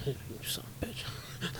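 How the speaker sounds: breathy whispered jab between laughs; laughing whisper. Real, not acted. Teasing his friend, a laughing whispered insult. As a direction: hiss it at your friend, amused.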